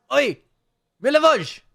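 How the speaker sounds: high, loud shout out the window; calling out. Acted. Priest shouting to Milivoj from the window, loud call. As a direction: yell across the graveyard.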